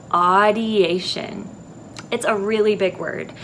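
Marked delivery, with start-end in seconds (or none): 0.1-1.5 audiation said slowly, falling; drawn-out audiation for kids to copy; slow repeat. Shown as a demonstration. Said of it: "say it one more time".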